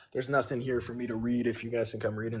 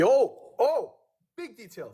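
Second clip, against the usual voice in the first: sudden oh; 9 semitones higher, 4 dB louder, much slower than usual. Real, not acted. Sudden realization of big detail.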